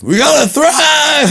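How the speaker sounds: high, slow shout cracking into laughter; laughing sergeant yell. Acted. Sergeant rallying the troops, laughing shout.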